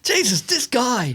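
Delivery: high, slow, drawn-out mock despair; can't believe this guy, slow and high; exasperated disbelief. Real, not acted. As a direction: groan it out in amused exasperation. Reacting to outrageous joke.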